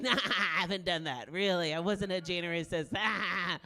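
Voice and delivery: loud, hammed-up jokey denial; joking denial. Comic imitates guy joking about serial killing, loud mock denial. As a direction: loudly laugh it off, imitating a joker. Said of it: "And he's like".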